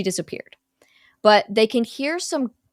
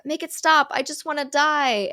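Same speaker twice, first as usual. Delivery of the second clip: higher, lightly acted desperate plea; retold plea, pitched up; mock pleading; 7 semitones higher than usual. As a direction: imitate a victim begging for it to end. Voicing victim snippets from the board.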